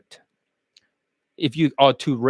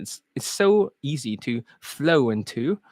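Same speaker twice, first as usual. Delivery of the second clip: put-on London accent; 5 dB quieter than usual. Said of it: "back to the London accent".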